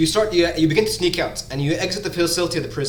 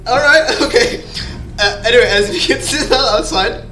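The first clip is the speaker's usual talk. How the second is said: words through leftover laughter; laughing; 5 semitones higher, 10 dB louder than usual. Real, not acted. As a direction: get back on track while still laughing. GM still laughing.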